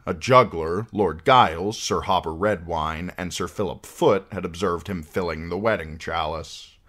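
plain reading voice

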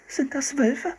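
put-on very high-pitched girl's question; young girl. A narrator's reading. Sister spotting dark shadows outside, high-pitched question. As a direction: ask in a small, high girl's voice. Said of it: "fragte Anne".

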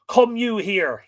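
loud, high summons from a chief; commanding Fionn. Acted. Fionn calls his butler to him, commanding shout. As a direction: call the servant over with command.

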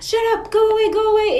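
high, loud mock yell to shoo; mom's mock shout. Mom yelling at the meowing cat to leave. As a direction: shoo the cat away, exasperated. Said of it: "so she'll be like".

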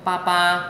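"pa pa" high and level, then a light second syllable; first-tone "pa" followed by neutral-tone "pa". Shown as a demonstration. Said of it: "gọi là thanh nhẹ".

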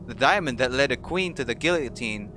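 measured, theatrical narration; dramatic article reading. Acted. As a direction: read it like a mysterious tale, leaning on key words. Reads an in-game article aloud.

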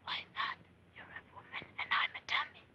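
dummy's breath-only whisper. Acted.

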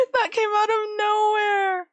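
high, loud laugh into words; real high laughing remark. Real, not acted. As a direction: burst out laughing then speak. Cracks up at chat.

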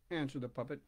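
steady storybook reading voice; story narration. A narrator's reading. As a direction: read it to the listeners like a bedtime chapter. Reads Pinocchio aloud.

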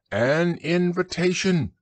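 a proper, slightly pompous owl; Owl's voice. A narrator's reading. Owl repeats himself.

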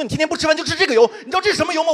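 high-pitched mock scolding; angry mother. Mother catching child with junk snack, angry scolding. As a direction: scold the child, climbing into a shout. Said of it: "我妈特别生气".

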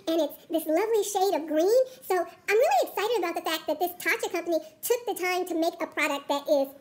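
ordinary talk, nothing marked

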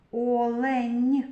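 Олень ending on a long soft нь; drawn-out soft Н. Shown as a demonstration. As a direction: linger on the final нь of Олень. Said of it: "М'який приголосний н".

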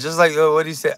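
normal talking voice

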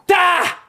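high, sudden "ta" for a slap; imitated smack. Streamer re-enacting mom's hit, high smack noise. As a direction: voice the blow as a high burst.

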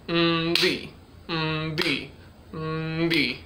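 hummed "Mm" leading into "D"; a long "Mm" pressed into each "D"; built-up letter sound. Shown as a demonstration. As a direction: build up on "Mm", then release "D". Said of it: "have a little build up".

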